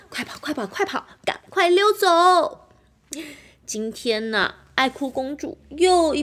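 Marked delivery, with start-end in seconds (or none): 0.1-2.7 panicked shout. Acted. Animals flee the crying princess.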